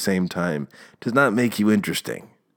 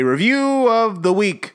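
announcing segment; 11 semitones higher, 5 dB louder, slower than usual. Real, not acted. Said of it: "I'm gonna tone it down".

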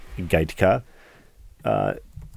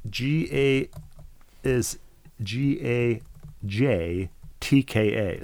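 slow letter-by-letter spelling; 3 semitones higher, much slower than usual. Shown as a demonstration. Host carefully spelling the listener's surname. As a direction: spell G A J T K A slowly. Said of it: "his often mispronounced last name".

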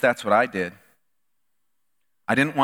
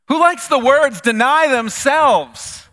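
louder, higher, stretching out deny themselves; rising preacher emphasis; 7 semitones higher, 5 dB louder, slower than usual. Real, not acted. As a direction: press the words home to the congregation. Preacher mocking self-denial.

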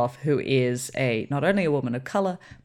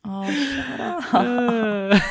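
slow, laughing, higher; 8 semitones higher, much slower than usual. Real, not acted. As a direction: laugh it off, drawn out. Laughing rebuke.

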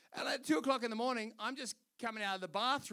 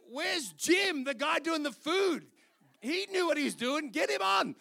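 loud, high, panicked cry for the other guy; panicked shout; 7 semitones higher, 10 dB louder than usual. Retold or imitated. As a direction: shout in alarm, pitch up. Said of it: "And I'm like".

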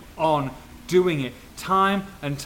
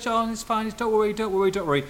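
fake-breezy reassurance; 7 semitones higher than usual. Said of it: "She's seething".

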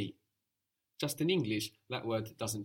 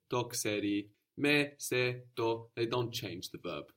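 usual speaking voice

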